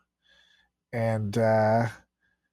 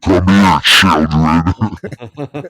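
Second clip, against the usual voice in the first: low, loud creepy beckon; 6 semitones lower, 11 dB louder, much slower than usual. Pretend. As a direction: lure them in, creepy. Creepy impersonation.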